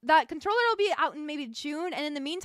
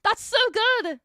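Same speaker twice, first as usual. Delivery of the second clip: excited; 6 semitones higher, 7 dB louder than usual. Retold or imitated. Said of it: "I was like".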